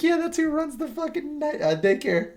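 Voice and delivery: very high, squeaky mock exclamation. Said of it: "I was like".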